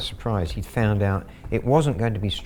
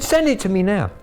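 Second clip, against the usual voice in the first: higher, louder barked demand; angry order; 10 semitones higher, 5 dB louder than usual. Retold or imitated. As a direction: snap the order like a furious scammer. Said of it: "He went mad".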